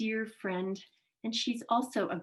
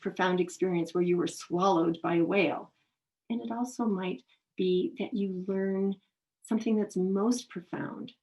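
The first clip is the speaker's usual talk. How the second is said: ordinary talk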